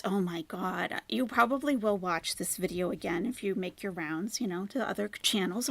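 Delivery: ordinary talk, nothing marked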